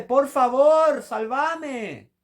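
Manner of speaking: agonized pleading shout, each word dragged out; desperate shouted plea. Acted. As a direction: beg God like you are falling into hell. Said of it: "Pedile, pero gritando, desesperado".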